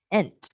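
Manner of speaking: "ent" said alone, nasal vowel and sharp T; ent sound. Shown as a demonstration. Teacher demos the word ending to learners, crisp. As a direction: model the ending "ent" clearly. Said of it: "and then I would say".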